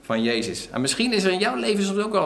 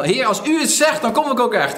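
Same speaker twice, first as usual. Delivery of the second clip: full of faith; 7 semitones higher, 5 dB louder than usual. Retold or imitated. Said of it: "vol geloof".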